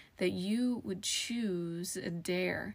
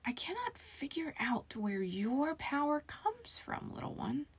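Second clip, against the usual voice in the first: high eerie whisper; 8 semitones higher than usual. A narrator's reading. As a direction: breathe it high and unvoiced. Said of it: "the mirror whispered".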